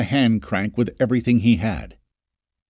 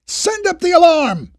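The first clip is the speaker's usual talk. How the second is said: urgent command; 6 dB louder than usual. Acted. Jacob commanding the crowd.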